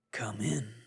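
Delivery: whispered invitation; bearded man whispering to the unseen intruder; undertone. Acted. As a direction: breathe it toward the door. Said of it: "in an undertone".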